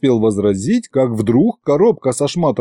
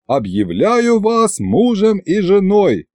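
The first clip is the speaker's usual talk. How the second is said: slow, high-pitched formal pronouncement; stately drawn-out wedding announcement; ceremonial proclamation; 8 semitones higher, slower than usual. A narrator's reading. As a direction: proclaim it grandly, stretching the words. Officiant solemnly finishes the ceremony.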